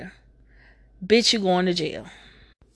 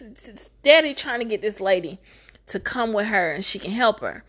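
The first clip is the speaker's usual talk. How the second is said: ordinary talk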